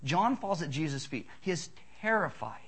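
ordinary talk

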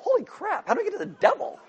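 put-on high, hushed startled kid; startled child. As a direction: gasp it out as a shocked seven-year-old. Child startled by mention of devil.

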